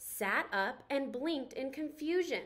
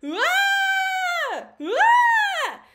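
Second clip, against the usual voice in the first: high, very loud terrified screams; cartoon craft shrieking in terror; panicked scream; 13 dB louder than usual. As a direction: scream and flee. Popsicle man screams and runs.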